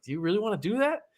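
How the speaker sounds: high mock voice asking doubtfully; lightly voiced sceptic; mock incredulous. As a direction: raise the pitch, pretend disbelief. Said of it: "And I was like".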